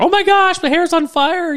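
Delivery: hammed-up panic in a high, loud squeal; weak-kneed coward. Co-host mocking paranoid overreaction to his partner, as a squealing weak-kneed coward. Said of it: "really good impression of ... a weak-kneed coward".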